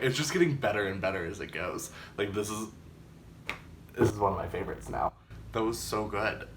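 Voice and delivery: ordinary talk, nothing marked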